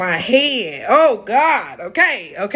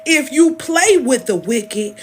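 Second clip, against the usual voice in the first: preaching; 3 semitones higher, 4 dB louder than usual. Acted. Preaching the line again.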